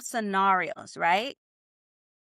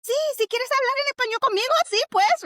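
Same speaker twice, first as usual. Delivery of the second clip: much higher, fast and eager; excited native speaker; 14 semitones higher, 5 dB louder, faster than usual. Pretend. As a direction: gush with excitement at a beginner. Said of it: "Then we're like".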